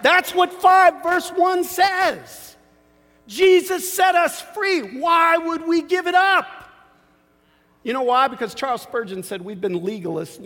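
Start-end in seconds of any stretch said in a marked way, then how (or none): 0.0-6.5 high, loud and slow, shouting the point home; fired-up preaching. Acted. Pastor shouting at the congregation, high, loud and slow. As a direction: preach it at full force to the room.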